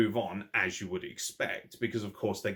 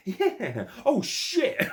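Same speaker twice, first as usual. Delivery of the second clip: high, laughing double take; laughing moron impression; 5 dB louder, much slower than usual. Vlogger imitating Austin Theory's double take, high and laughing. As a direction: play the dumb heel's shocked double take, giggling. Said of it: "He's like".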